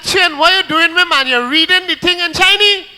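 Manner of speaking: high, loud outraged yell; cheated customer shouting at the vendor; exasperated shout. Retold or imitated. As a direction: yell in disbelief at being cheated. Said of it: "The man said".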